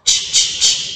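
吃 said breathy and unvoiced to show the ch sound; just the ch of 吃 as a whispered sound; ch sound demo. Shown as a demonstration. Teacher to the class, showing the ch sound. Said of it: "刚才老师教什么？".

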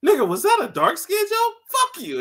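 high, loud mock offense; hammed-up offended yell; mock outrage. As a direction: snap back, hammed up. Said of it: "He's like".

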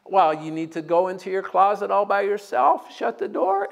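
usual speaking voice

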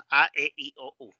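A, E, I, O, U as "ah, eh, ee, oh, oo"; quick Spanish list A, E, I, O, U; pure vowels on A, E, I, O, U. Shown as a demonstration. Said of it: "En español es".

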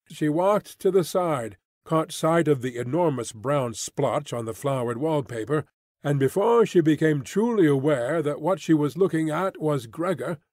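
plain reading voice

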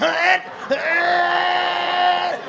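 high, long mock strain; hammed-up squeezed straining noise; straining grunt. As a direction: grunt as if pushing extra hard. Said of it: "Sometimes you push extra hard".

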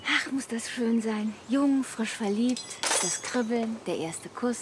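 dreamy. Acted. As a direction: drift off into sweet memory. Schwärmt von Verliebtheit. (verträumt und langsam)

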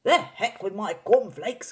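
slow, high, booming dismissal; loud excited cry. A narrator's reading. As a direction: brush it aside at full volume. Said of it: "cried Mister Cranky".

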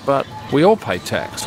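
ordinary talk

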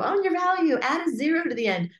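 high, loud mocking advice. Coach mocking common advice, high and loud. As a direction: parrot the cliché loudly. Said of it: "and people go".

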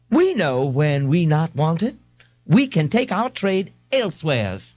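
huffy New Orleans mother; offended mother. Acted. Mrs Riley voiced.

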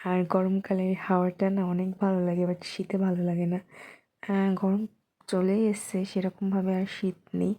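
normal talking voice

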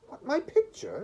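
high, loud, appalled exclamation; aghast, voice leaping up; exclaiming. A narrator's reading. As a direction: cry out in shocked protest.

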